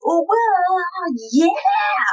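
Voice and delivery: high, drawn-out mock wail; mock belted outburst. Imitating own singing self.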